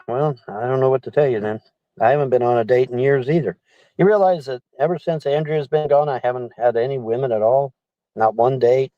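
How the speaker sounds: usual speaking voice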